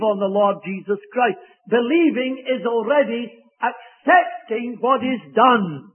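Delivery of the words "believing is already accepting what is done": ordinary talk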